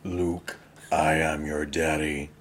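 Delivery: a ponderous, deep villain reveal, played for laughs; Darth Vader. Co-host spoofing a film line about fatherhood, slow deep villain voice. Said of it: "like a James Earl Jones".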